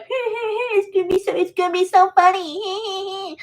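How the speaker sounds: a bratty sister sure she's hilarious; teasing sister voice. Retold or imitated. Imitating her sister brushing off her begging, high and teasing. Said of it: "And she's like".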